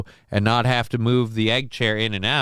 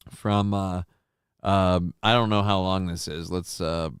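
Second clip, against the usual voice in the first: usual speaking voice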